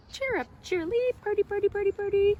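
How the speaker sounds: high, bouncy bird call; high cheery birdie calls; cardinal song. Acted. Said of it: "The cardinal wears a pointy hat".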